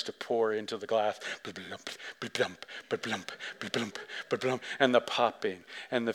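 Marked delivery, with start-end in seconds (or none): 1.4-4.6 soft low "blump" glugging noises; mock pouring glug. Telling the congregation about the ad, soft glug sounds. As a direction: imitate soda glugging into a glass.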